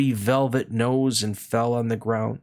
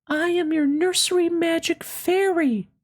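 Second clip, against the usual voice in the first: very high, gentle fairy voice. Acted. Fairy greeting the little rabbit, high and gentle. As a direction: sing it out light and high like a fairy. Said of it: "she said".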